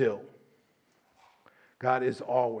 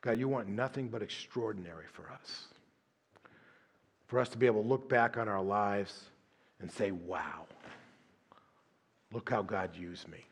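low, soft, unhurried prayer voice; closing prayer; 5 semitones lower, 3 dB quieter than usual. Acted. Pastor closes the sermon praying over the congregation, hushed and low. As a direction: pray it quietly, with long pauses.

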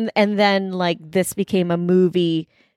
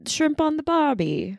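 higher-pitched mock Aussie quote; mock Australian accent; 7 semitones higher, 4 dB quieter than usual. Guessing a movie quote, mock Australian accent. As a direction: try the line in a light Australian accent.

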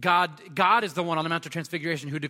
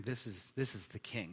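much softer and lower, hushed recognition; awed centurion; 5 semitones lower, 10 dB quieter than usual. Retold or imitated. Centurion at the cross recognizing the king, hushed. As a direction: breathe it out in awe. Centurion declaring against his emperor.

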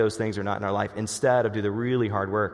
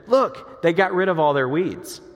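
imitating my kid; 7 semitones higher, 5 dB louder, slower than usual. Kid points at mowed yard.

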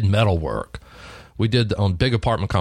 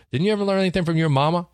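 mock boss retort; 8 semitones higher, much faster than usual. A boss teasing his young salesman, quicker and higher. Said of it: "Mike looked at me and said".

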